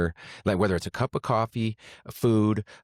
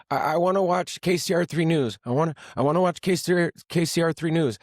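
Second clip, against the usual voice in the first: much higher, fast insistent mom voice; higher mock mom whining for the news; imitating mom; 9 semitones higher than usual. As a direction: nag like his mother wanting the TV. Voicing mom demanding the news.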